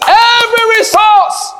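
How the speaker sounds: high, loud burst; preacher stressing every resource; preaching shout. Real, not acted. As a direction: belt out the phrase.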